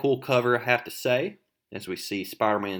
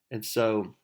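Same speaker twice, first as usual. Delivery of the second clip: usual speaking voice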